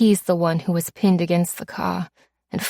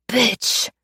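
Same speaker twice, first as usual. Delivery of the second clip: breathless, higher spat-out curse from the ground; wheezed insult; 4 semitones higher than usual. Acted. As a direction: wheeze it out, winded and hurt. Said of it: "he wheezes".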